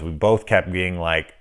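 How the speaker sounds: ordinary delivery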